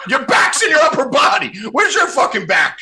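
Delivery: high, loud disbelieving shout; laugh-yell at the lame excuse; incredulous. Real, not acted. Host mocking co-host's injury excuse, shouting high.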